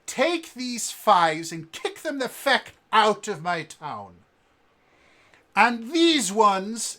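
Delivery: loud commanding yell; furious innkeeper commanding guards; angry orders. Acted. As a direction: bark at the guards.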